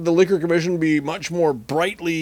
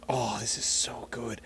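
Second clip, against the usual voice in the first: whisper; 6 semitones lower, 12 dB quieter than usual. Real, not acted. Savoring the beer, whispered.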